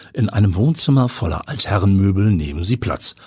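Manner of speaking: ordinary delivery, nothing marked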